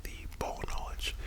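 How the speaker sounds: normal talking voice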